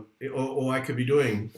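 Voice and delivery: usual speaking voice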